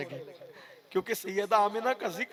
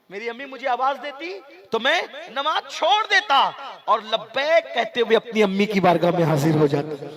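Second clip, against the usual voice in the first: impassioned cry; 3 semitones higher, 9 dB louder than usual. Acted. Preacher repeating the Prophet's words to the crowd, crying out loud. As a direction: build the repeat into a full-throated cry.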